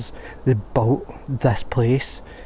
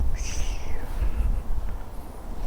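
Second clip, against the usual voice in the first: heavy exasperated sigh; 5 dB quieter than usual. Real, not acted. Exasperated over smashed graves.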